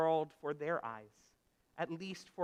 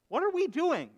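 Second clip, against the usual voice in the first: higher, louder startled question; lightly acted dawning realization; sudden realization; 10 semitones higher, 7 dB louder than usual. A narrator's reading. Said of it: "had a realization and asked".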